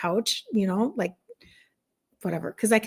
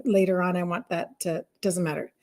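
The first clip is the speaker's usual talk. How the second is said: ordinary delivery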